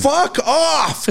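very high mock scream; retold scream. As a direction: imitate the coach screaming at the screen. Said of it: "He just screams into the".

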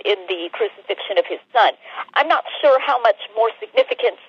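normal talking voice

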